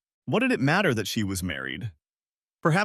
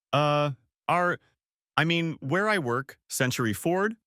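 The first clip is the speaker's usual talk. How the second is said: stammering; 5 semitones higher than usual. A narrator's reading.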